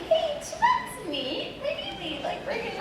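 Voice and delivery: very high, soft, sugary coaxing; drawn-out, falsetto mock niceness; overly nice. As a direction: imitate someone tiptoeing sweetly round an actor. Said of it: "they're just really overly nice to you".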